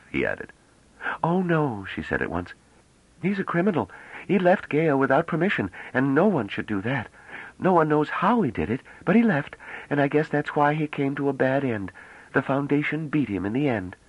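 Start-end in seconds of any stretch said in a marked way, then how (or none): 1.2-1.9 put-on bright, eager girl's voice; young woman voice. A narrator's reading. 3.2-14.0 put-on bright, eager girl's voice; young woman voice. A narrator's reading.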